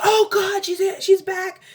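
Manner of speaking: very high reenacted exclamation; overwhelmed cry. Mother finds her daughter back, imitated overwhelmed cry.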